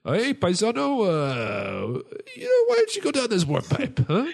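very high, hammed-up Italian accent; mock Italian Mario. Mario tricks Higgins down the pipe in a mock Italian accent. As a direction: sell him the warp pipe like a shifty Italian uncle.

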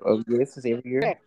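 ordinary delivery

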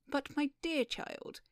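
astonished, drawn-out appeal; crying out. Acted. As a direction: protest to a naive youngster. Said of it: "cried Beau Maturin".

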